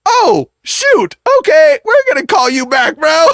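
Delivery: high, whimpering alarm; mock panic. Man hurriedly ending the exposed friend's call, panicked. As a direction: squeal in hammed-up embarrassment and bail out.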